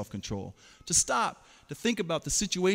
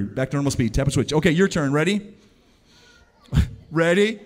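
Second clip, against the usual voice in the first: usual speaking voice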